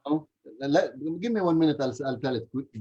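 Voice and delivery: ordinary talk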